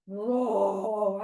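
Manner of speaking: long, drawn-out roar; forest bear roar. Pretend. The forest bear has been found, big roar. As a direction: play a big bear roaring. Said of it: "we found the forest bear".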